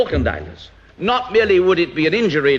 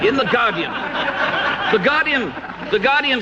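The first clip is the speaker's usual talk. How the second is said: chuckling through the paper's name; a scornful laugh as he names it; amused mockery at the newspaper; 9 semitones higher than usual. Real, not acted.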